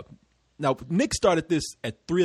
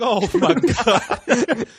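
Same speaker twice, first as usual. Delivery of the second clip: higher, very slow laughing oh my God; laughing disbelief; 6 semitones higher, 5 dB louder, much slower than usual. Retold or imitated. Hearing it's only nine thirty, laughing dismay. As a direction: stretch it out through a laugh. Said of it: "I was like".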